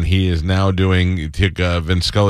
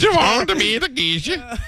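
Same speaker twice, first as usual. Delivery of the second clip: mock Japanese accent.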